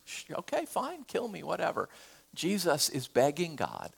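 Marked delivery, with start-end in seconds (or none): 0.1-1.9 mock nonchalance. Mock Polycarp shrugging off death.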